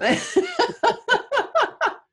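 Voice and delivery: long, high-pitched laugh; real laugh in a high, rolling run; hearty laugh. Real, not acted. Host laughs at her own joke.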